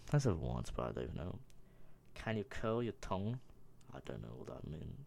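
ordinary talk

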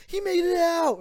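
high, loud imitation of a lookout; mock alarmed shout. Counselor spotting the escaped boy on the main road, mock alarmed shout.

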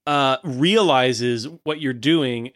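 usual speaking voice

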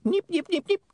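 very high repeated nips; tiny high-pitched imp chirps; fast-forward squeaks. Acted. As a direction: squeak like a skipping tape. Imp imitates fast forward noise.

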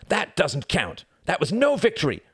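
high-pitched, outraged objection; indignant protest. A narrator's reading. Reginald marches up to Tom to dispute victory, indignant protest. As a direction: argue it hotly, pitched high. Angry boy confronts his brother.

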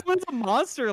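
high, disbelieving outburst; incredulous. Real, not acted. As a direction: say it like you can't believe it. Outraged disbelief at ban.

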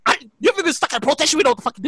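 ordinary delivery

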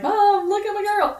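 high, bouncy proud squeal; giddy prince. Retold or imitated. Prince presenting his girl to his mom, giddy high squeal. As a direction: show her off like an overjoyed boy.

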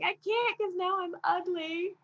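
high-pitched, hammed-up tearful wail; mock crying. Crying woman refusing the group picture, mock crying. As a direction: whine it high as if bawling, playfully exaggerated. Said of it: "I started crying again".

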